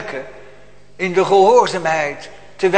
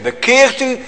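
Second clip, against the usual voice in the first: God's command; 6 semitones higher than usual. Retold or imitated. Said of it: "Hij zegt".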